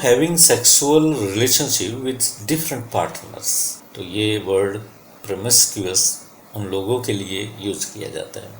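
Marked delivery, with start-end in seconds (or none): none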